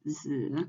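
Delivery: z said as 子 in the third tone; z as a full syllable, not neutral tone. Shown as a demonstration. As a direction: give z a full, low third tone. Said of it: "the original pronunciation is the third tone".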